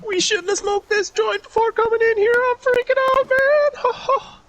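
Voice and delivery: very high-pitched cartoon stoner impression; Shaggy. Freaking out to Scoob about a smoked joint, Shaggy impression. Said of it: "supposed to be that Shaggy".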